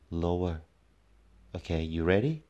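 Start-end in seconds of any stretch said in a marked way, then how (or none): none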